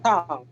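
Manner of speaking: "Sam" with a short falling Chinese fourth tone; cuarto tono. Shown as a demonstration. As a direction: drop the pitch sharply through "Sam". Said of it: "¿Y el cuarto?". ("Sam" en cuarto tono, corto y descendente)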